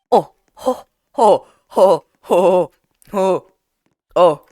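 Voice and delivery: loud, repeated "oh" outbursts; string of separate loud exclaimed ohs; startled "oh" cries. Real, not acted. Gamer reacting to danger in the game, repeated startled cries.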